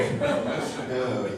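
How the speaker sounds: ordinary talk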